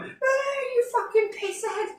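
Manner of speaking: high, thin mock yell held back in volume; imitated shouting. Driver shouting at a drunk in the road, high mock yell. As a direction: imitate a heckler yelling from far off. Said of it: "Someone shouting out some".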